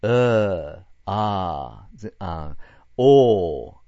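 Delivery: drawn-out vague vowel sounds, loud; 曖昧音の実演. Shown as a demonstration. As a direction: stretch the murky vowel out loud. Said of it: "曖昧音っていうか". (曖昧な母音を大きく伸ばして)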